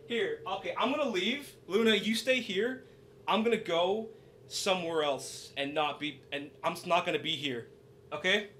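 faint pretend conversation across the room; away from the mic. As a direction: talk as if off at the door. Stepped away to greet pretend visitor.

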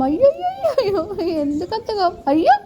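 squeaky high "aww" that melts into a giggle; high cooing sympathy. Real, not acted. Mother teasing her sulking baby girl, high giggly coo. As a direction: coo up high to tease the sulk away.

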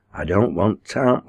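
ordinary delivery

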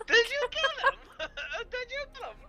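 very high mock shriek through laughter; laughing, squeaky impersonated outburst; imitated screaming. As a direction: squeal it like a panicked teammate, cracking up. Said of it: "You hear Stoner screaming at the end".

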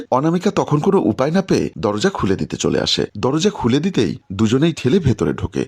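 ordinary delivery, nothing marked

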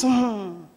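low, doubtful hum; devil's insinuating hmm. Retold or imitated. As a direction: hum low to plant suspicion. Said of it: "He speaks".

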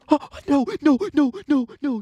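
high panicked string of no's, rising to a squeal. Real, not acted. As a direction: squeak out the no's as the jump slips away. Nearly falls on a jump.

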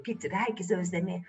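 ordinary delivery

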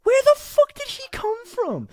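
high-pitched, loud squeal of disbelief; incredulous outburst. Real, not acted. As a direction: shriek it, baffled and about to laugh. Amazed by replayed clip.

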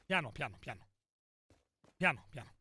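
low, soft self-coaching; cautious muttering. Real, not acted. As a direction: murmur it low and quietly to yourself. Si frena da solo, sottovoce. (mormorio cauto e sommesso)

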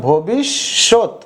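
"ভবিষ্যৎ" slowly as bhobish, shot; syllable by syllable. Shown as a demonstration. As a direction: hold the sh and split "ভবিষ্যৎ". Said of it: "কারণ উচ্চারণটা হয়েছে". (ভেঙে ধীরে "ভবিষ্যৎ")

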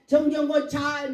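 loud, stretched call by name. Retold or imitated. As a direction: imitate someone hailing a pastor. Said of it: "막 불렀지".

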